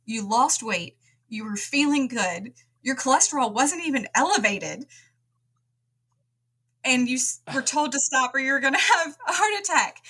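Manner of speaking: high, incredulous rising voice; retold disbelief. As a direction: sound stunned by the absurd advice. Retold disbelief at doctor's advice.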